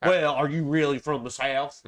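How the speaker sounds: exaggerated Southern accent, skeptical question; doubting Southerner. Southerner quizzing a Charleston native with no accent, doubting Southern accent. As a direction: mimic a suspicious local in Southern accent. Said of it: "They're like".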